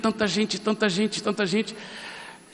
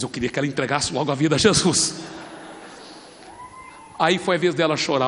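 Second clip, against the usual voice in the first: ordinary talk, nothing marked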